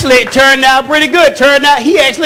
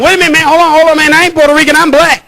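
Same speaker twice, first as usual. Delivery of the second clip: panicked plea, higher and louder; freaking out; 6 semitones higher, 4 dB louder, faster than usual. Retold or imitated. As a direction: beg the cop in a rush of fear. And so I start freaking out.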